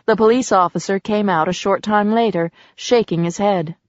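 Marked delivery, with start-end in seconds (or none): none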